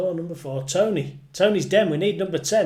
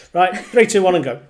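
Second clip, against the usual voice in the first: loud, punchy countdown; 3 semitones higher, 11 dB louder than usual. Real, not acted. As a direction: count them in big and loud. Countdown to start the game.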